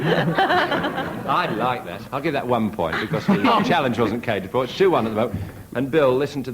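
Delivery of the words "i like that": slow words through laughter; drawn out and laughing. Real, not acted.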